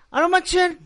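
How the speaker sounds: put-on high, loud slurred drunk voice; drunk man. Drunk at a bar sees dwarfs everywhere, high loud squeal. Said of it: "llega un borracho ... y dice".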